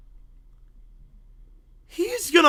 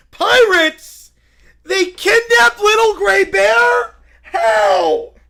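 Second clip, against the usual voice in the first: panicked shout; 9 semitones higher, 10 dB louder, much slower than usual. Acted. Bear shocked by kidnapping.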